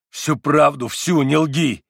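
громко и требовательно; требовать правды громко; настойчивый крик. A narrator's reading. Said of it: "повторил Митя". (Митя громко требует правды)

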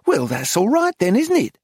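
high, lifting tag question; forced lightness. A narrator's reading. Dealer tries to laugh it off, forced lightness. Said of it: "She tried to laugh".

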